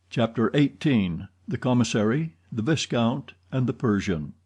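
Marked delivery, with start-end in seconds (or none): none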